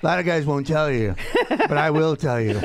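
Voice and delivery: fast, pushy, upbeat creep; creepy boyfriend voice. Acted. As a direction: play a cheerful stalker. Playing the creepy tracker guy.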